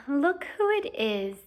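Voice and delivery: slow, lazy sneering drawl. Acted. Malfoy sneers at Harry in a lazy drawl. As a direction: draw it out like a bored bully. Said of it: "in his usual lazy drawl".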